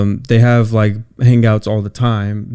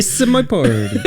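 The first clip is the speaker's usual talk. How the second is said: laughing; 6 semitones higher than usual. Real, not acted. Laughing while repeating phrase.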